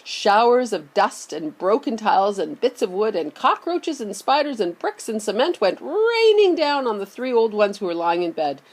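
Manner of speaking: ordinary delivery, nothing marked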